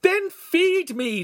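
very high, slow scream; screaming back. A narrator's reading. Starving apprentice answers master, screaming back. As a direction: scream it back, stretching each word. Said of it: "Lincoln screamed back".

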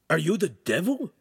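high, fearful question; awed question. Acted. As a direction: ask it in dread, rising at the end. Frightened Ugolino questions demon.

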